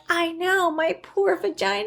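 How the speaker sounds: high, whiny mock lament; mock self-pity. Retelling her reply to a friend, high comic lament. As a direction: wail it in playful self-pity.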